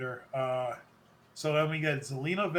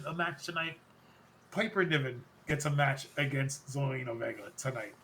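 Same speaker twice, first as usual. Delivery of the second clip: usual speaking voice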